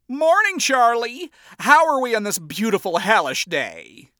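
high, loud theatrical hello; showman greeting. A narrator's reading. Lucifer greeting his daughter on the stairs, showy. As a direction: make a grand entrance. King of Hell's grand entrance.

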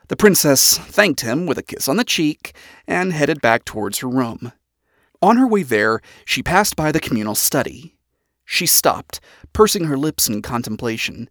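ordinary delivery, nothing marked

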